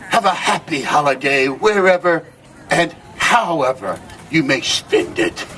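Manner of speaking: normal talking voice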